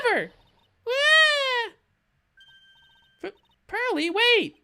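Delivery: put-on a heartbroken child who bursts out; high girl voice rising to a wounded cry; hurt little girl. Voices little girl character storming off.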